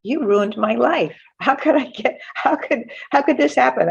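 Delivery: high, tearful cry of despair; retold tearful wail, mildly voiced; crying plea. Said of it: "and I'm crying, like".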